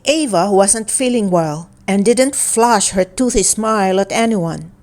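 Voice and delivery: ordinary delivery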